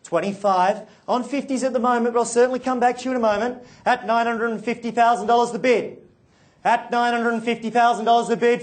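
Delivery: rhythmic auction bid calling; auctioneer call. Real, not acted.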